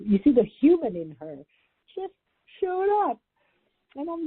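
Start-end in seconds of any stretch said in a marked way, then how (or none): none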